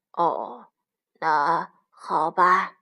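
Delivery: low, slow giving in; resigned low wolf voice; reluctant agreement. A narrator's reading. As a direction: give in slowly as the cowed wolf. Wolf gives in to mother.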